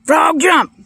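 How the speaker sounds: loud, very high holler; mock-frustrated shouted order; yelled command. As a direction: yell it at a frog that won't budge. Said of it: "kept yelling".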